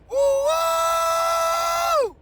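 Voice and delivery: high, loud joyful whoop; ecstatic whoop. Real, not acted. Morning preacher whooping for his viewers, ecstatic whoop. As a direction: let out a wild happy whoop. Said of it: "Man, I'm excited".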